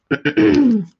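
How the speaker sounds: clears throat sharply; a quick rough throat clear. Real, not acted. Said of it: "my throat is getting all crazy again".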